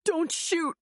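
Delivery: high pleading call. A narrator's reading. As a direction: call out to stop the shooting. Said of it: "A voice called out".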